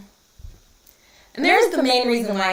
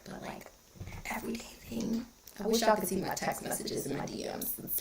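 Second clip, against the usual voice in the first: whisper; 10 dB quieter than usual. Real, not acted. Confiding aside to live viewers.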